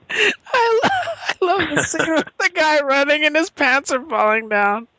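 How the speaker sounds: high, squeaky voice through real laughter; helpless high-pitched giggling delight; laughing while talking. Real, not acted. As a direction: crack up while getting the words out. Cracking up over a favourite scene.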